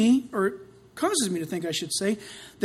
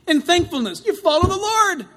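emphatic refrain; 7 semitones higher, 4 dB louder than usual. Shown as a demonstration. Answer repeated louder as refrain.

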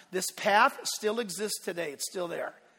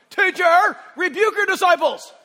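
high, loud sneering rebuke; preacher voicing the Pharisees mockingly; mocking Pharisee demand; 8 semitones higher, 9 dB louder than usual. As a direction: scold like an indignant Pharisee. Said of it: "I have to say it that way".

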